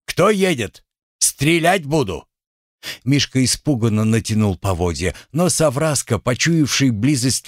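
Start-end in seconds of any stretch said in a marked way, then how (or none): 0.1-2.3 high, urgent sentry shout; shouted challenge. Acted. Sentry challenging rider at night.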